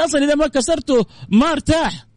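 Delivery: high mock-insistent voice; artist insists. Host voicing the artist insisting, high and mocking. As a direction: press the point like a stubborn artist.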